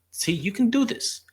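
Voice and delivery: high, bright cheer of encouragement. Retold or imitated. Therapist urging a doubtful patient in a wheelchair, high excited cheer. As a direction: pump them up like a coach on the sidelines.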